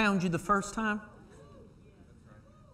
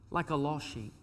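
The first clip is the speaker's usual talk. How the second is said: soft psalmist's plea; 2 semitones lower, 6 dB quieter than usual. Retold or imitated. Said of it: "He says".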